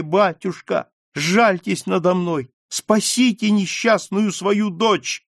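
high, pleading, near tears; desperate plea. Acted. Daughter begging her father to save her, high and pleading. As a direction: beg your father for mercy, voice climbing.

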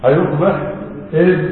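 normal talking voice

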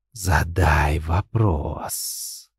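very slow, low, soft rustle like dry leaves; a whisper of autumn leaves; rustling inner voice. Acted. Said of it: "похожий на шелест осенней листвы". (шелестящий голос, как осенняя листва)

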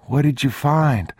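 put-on high, eager child's question; young boy. A narrator's reading. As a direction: ask like a curious small boy. Boy voiced by narrator.

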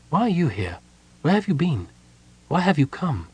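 high, gentle questioning voice; questioning woman. A narrator's reading. Gold Moon questions the kender she recognizes, gently.

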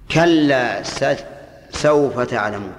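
very slow, drawn-out reading; slow quoted verse. Shown as a demonstration. Teacher quoting Surat al-Takathur to students, drawn out. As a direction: stretch each word of the verse. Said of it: "في سورة ألهاكم".

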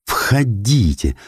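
very slow, overcome with feeling; choked with emotion, drawn out; shaken. Acted. Shaken old man welcomes children. (потрясённо, медленно)

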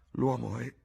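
"L'uomo è" left hanging, pitch up; breaking off unfinished. A narrator's reading. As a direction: stop short after "è", unable to go on. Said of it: "Non riuscì a finire la frase".

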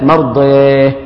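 مرضيه with the long a leaned toward e, sung out; recited مرضيه, alif tilted to e; with imala. Shown as a demonstration. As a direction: chant مرضيه with imala and a final h. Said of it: "ويميل الألف".

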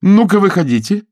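high, loud order; shouted command. A narrator's reading. Jane orders the man out of the armour, shouting. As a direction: call out sharply, pitched well up. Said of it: "крикнула она".

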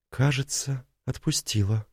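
quiet. Acted. Tina's quiet relieved reply.

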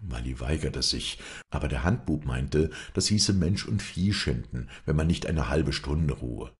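usual speaking voice

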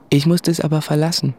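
ordinary talk, nothing marked

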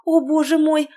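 high, loud cry of dismay; shocked gasp. A narrator's reading. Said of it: "воскликнула Диана".

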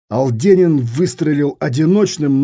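plain reading voice

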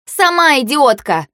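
high, drawn-out comeback; angry retort. A narrator's reading. Girl answering her rival's insult, angry retort. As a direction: throw the insult back.

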